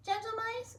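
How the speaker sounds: very high squeaky scientist voice; professor. Acted. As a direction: address the mice like a prim professor. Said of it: "said Professor O'Hare".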